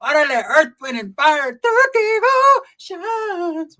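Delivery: high, loud, stretched last word; high drawn-out party call; hyped-up aunt. Retold or imitated. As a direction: call for the band like a party auntie. Aunt calling for a song.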